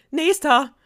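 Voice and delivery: high, loud, fed-up shout; annoyed call. A narrator's reading. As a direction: bark the next one in, annoyed. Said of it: "rief sie genervt". (genervt und laut „Nächster!“ rufen)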